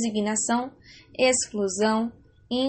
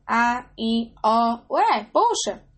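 acted surprise; 3 dB louder, much slower than usual. Shown as a demonstration. Teacher showing students a surprised exclamation. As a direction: sound amazed at the word.

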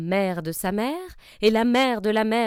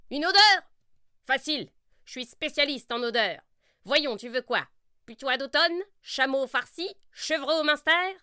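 sorcière sûre d'elle; 5 semitones higher than usual. Acted. Sorcière experte en odeurs.

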